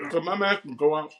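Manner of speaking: talking through a mouthful; chewing while talking. Real, not acted. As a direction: speak around hot wing bites. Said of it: "I'm sorry, I'm chewing".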